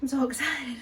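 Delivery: breathless after trying on clothes; out of breath. Real, not acted. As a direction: sigh it out, short of breath. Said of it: "out of breath".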